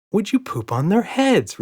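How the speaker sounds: high, slow, cheeky repeat; smiling repeat. A narrator's reading. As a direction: say it again with a grin. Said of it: "repeated Emma with a smile".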